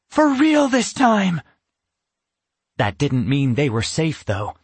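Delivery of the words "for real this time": high triumphant voice. A narrator's reading. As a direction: relish the escape. Triumphant escape.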